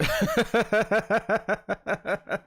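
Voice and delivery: high burst of laughter. Real, not acted. Laughing at meet cute.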